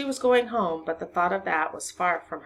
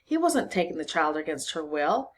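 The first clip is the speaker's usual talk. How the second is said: plain reading voice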